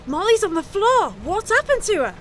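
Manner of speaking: high-pitched shocked cry after a gasp; gasp, then ask in rising alarm; acted shock at finding a friend collapsed. Friend finds victim collapsed.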